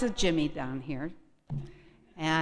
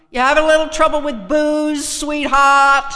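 loud, high Boston tough-guy voice; Boston accent; 7 semitones higher, 11 dB louder than usual. Retold or imitated. AA phone volunteer answering the call, loud Boston accent. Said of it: "That's how they talk up there".

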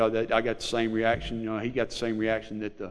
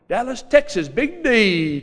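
much higher and louder, savoring the big city; excited city boast; 11 semitones higher, 7 dB louder, slower than usual. Real, not acted. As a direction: sell it like the best place on earth. Celebrating big city life.

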